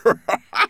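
loud, high chuckle; laugh. Acted. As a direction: chuckle at the joke. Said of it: "Professor Rosenberg laughed again".

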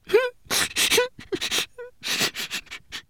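high, squeaky little sobs; sobbing. A narrator's reading. Tiny homunculus afraid of being left behind, sobbing. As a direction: burst into thin high weeping. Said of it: "they suddenly heard a sob".

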